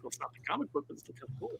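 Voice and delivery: soft, half-whispered verdict; quiet aside. Retold or imitated. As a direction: murmur it grudgingly. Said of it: "I was like".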